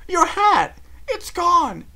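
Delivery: high, loud alarmed call; Rarity crying out. A narrator's reading. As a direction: shriek her friend's name.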